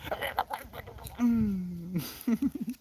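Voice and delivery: long, soft letting-go sigh; sigh of release. Shown as a demonstration. Showing how the anger melts away, drawn-out sigh. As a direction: breathe the tension out slowly.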